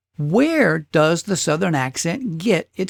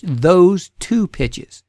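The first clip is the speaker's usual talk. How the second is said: slow emphasis; much slower than usual. Shown as a demonstration. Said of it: "not just any low pitch or high pitch".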